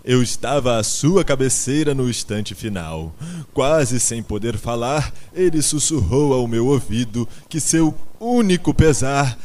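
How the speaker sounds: loud, theatrical grieving declamation; tearful orator. A narrator's reading. Garganta relays Sansão's last words, declaiming with emotion. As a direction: deliver a stirring, drawn-out eulogy. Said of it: "deixando rolar uma lágrima".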